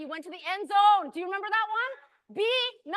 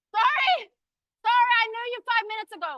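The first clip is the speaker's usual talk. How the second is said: high, loud, hurried sorry; called-out apology; 5 semitones higher, 8 dB louder than usual. Real, not acted. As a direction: call out a quick apology over the room. Calls apology about the time.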